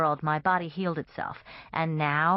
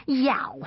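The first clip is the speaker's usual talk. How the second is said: pained yelp; 8 semitones higher than usual. Acted. Stabbed in thigh.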